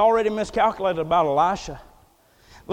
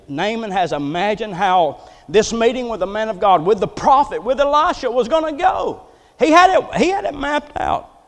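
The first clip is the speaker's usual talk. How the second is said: usual speaking voice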